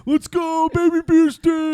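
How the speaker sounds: high, loud party cheer; frat bro. Retold or imitated. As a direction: hype him up like a frat bro chugging. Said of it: "I have video on my phone of me going".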